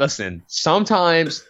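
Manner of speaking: high, slow, drawn-out protest; defensive protest. Real, not acted. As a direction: stretch it out high in mock defense. Defends his parlay bet.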